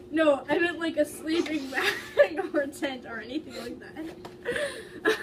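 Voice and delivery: words breaking up in laughter; trying to read through giggles; laughing. Real, not acted. Reader cracking up at the fan fiction, laughing.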